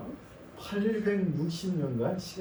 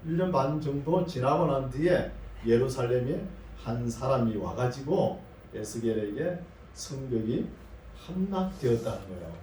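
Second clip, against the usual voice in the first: ordinary delivery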